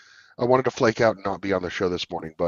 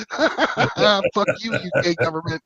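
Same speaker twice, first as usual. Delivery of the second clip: laughing taunt; 10 semitones higher, much slower than usual. Real, not acted. Laughing defiant jab at UK.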